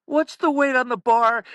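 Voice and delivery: loud, high mock coach's call. Coach sticking his head out at the announcers, calling out. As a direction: holler the question like a pestering coach.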